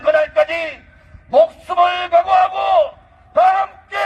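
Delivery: shouted high political rally call; roaring rallying speech. Acted. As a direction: rouse the crowd at full force.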